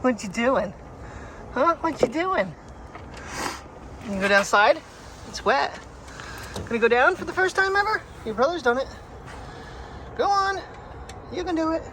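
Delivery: high, loud pet talk, urging her down the slide; cheering the dog on. Real, not acted. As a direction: owner cheers Priya on to try the wet slide, high and loud.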